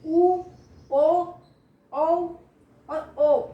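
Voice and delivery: teacher's loud, separate back-vowel demo. Shown as a demonstration. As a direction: spell out each back vowel clearly for the class. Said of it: "पश्च स्वर बाद का उ अंदर आ रहा है".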